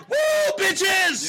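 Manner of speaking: high, loud whooping cheer; real party holler; rowdy toast. Real, not acted. Raising a shot to Bar Talk, rowdy whoop.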